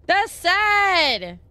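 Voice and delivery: loud, high cry of dismay; dismayed outcry. Real, not acted. Streamer reacting to killed cockroaches, dismayed outcry. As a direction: exclaim it loudly, upset.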